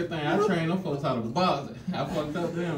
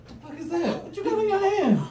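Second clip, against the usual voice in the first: shocked; 13 semitones higher than usual. Retold or imitated. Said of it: "I said".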